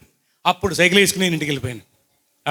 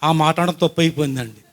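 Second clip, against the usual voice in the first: ordinary talk, nothing marked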